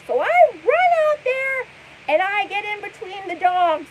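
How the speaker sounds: exaggerated hyped storyteller impression; hyper mimicry. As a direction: imitate her near-screaming story. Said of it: "hyper, like, almost screaming".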